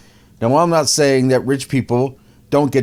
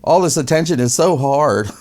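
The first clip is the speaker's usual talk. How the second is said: higher, hammed-up whiny wife; whiny mock victim; 6 semitones higher than usual. Wife complaining to the host in a high mock whine. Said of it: "That's what she says".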